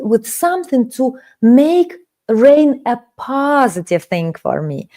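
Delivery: ordinary talk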